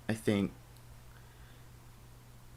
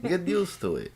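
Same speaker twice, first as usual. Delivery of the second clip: higher, lightly put-on Russian accent; mock Russian accent; 10 semitones higher than usual. The husband lectures his Russian bride in a mock Russian accent. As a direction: tease her in a hammed-up Russian accent. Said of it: "I don't know why I'm Russian too".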